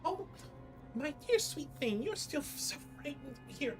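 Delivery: high, quick fairy chatter; pixie voice. Acted. GM voicing pixie.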